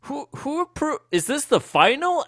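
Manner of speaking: high-pitched, stammering disbelief; baffled Duffer Brothers. Retold or imitated. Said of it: "they're like".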